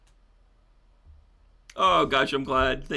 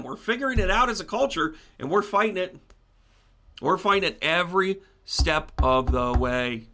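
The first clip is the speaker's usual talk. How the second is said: usual speaking voice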